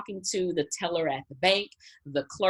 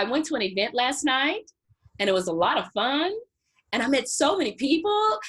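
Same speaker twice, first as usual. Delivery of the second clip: uptalk, every statement rising like a question; rising cadence; 5 semitones higher, 3 dB louder than usual. Shown as a demonstration. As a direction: end each line on a lift. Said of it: "Give me an example".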